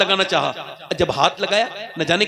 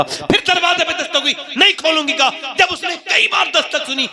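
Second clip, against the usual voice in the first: rising excitement; 8 semitones higher than usual. Acted. Knocking builds, preacher rising.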